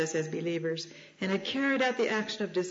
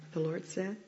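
normal talking voice

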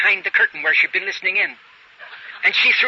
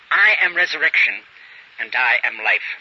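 both "I am" phrases hit with a falling tune; preacher rereading the verse with every article gone; I am stressed twice; 7 semitones lower than usual. Shown as a demonstration. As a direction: read "I am resurrection and I am life" with weight on each "I". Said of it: "you can read it".

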